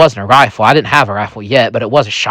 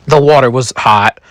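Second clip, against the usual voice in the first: ordinary delivery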